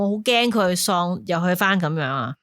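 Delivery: usual speaking voice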